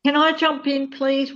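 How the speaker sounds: normal talking voice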